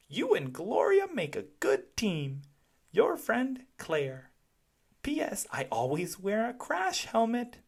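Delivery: put-on high little-kid voice reading a letter; child's letter. A narrator's reading. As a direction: read it like a small kid proud of his note. Child's letter read aloud.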